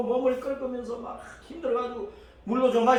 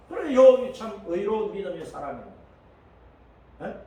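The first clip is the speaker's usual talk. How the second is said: normal talking voice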